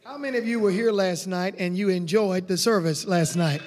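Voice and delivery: ordinary talk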